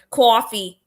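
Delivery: "Coffee" in a loud, overdone accent; exaggerated regional accent. Shown as a demonstration. Woman teased about her accent repeats coffee, exaggerated accent. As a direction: lean hard on the accented vowel of "Coffee". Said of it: "get mocked for my accent".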